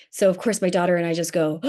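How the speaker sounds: usual speaking voice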